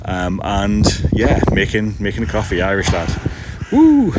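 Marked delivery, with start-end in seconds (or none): 3.7-4.1 high, loud cheer; a sudden jump into a high, loud woo; excited whoop. Real, not acted. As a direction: whoop it out with real glee. Cheering at the viewer's coffee.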